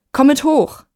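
high-pitched insistent plea; urging. A narrator's reading. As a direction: urge him to come along. Pleading with neighbour to come upstairs.

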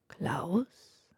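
a hollow whisper from the grave; sepulchral whisper. Acted. Death waking the dying man, whispering from the grave. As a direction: breathe the name in a ghostly whisper. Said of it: "flüstert der Tod mit Grabesstimme".

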